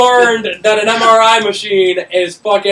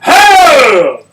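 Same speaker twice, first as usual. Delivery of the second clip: loud, high barked command; drill sergeant yell; 9 semitones higher, 9 dB louder than usual. Retold or imitated. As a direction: bark it at the whole warehouse. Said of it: "I had the loudest voice".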